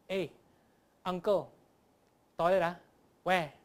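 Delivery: short, chopped Singlish phrases with pauses; pared-down Singaporean-accented question. Shown as a demonstration. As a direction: ask it the Singlish way, word by word. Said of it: "say it in the correct Singlish way".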